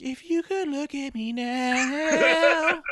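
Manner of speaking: slow, high, eerie crooning line; a hammed-up creepy Fiend voice; mock Fiend gaze. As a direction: croon it slowly to the picture. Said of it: "you're like".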